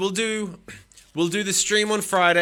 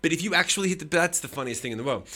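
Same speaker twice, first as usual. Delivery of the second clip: normal talking voice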